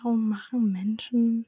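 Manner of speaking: soft unvoiced whisper, slow; whispered prompt. Real, not acted. Thinking aloud over the quiz, she whispers the prompt. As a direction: whisper the words while thinking.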